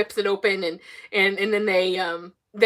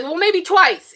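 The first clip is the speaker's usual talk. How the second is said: high, loud mock-nervous admission; retold panic; 8 semitones higher, 5 dB louder, slower than usual. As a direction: imitate a cornered mother owning up. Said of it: "she starts to panic".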